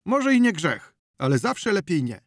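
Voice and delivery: high, loud insistent villager voice; peasant retort. Acted. A villager insisting it is still better not to, high and loud. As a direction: press the point stubbornly. Old peasant's cautious rebuttal.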